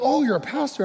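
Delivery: imitating a startled missionary, way up high; surprised question. Missionaries react to the pastor, in imitated surprise.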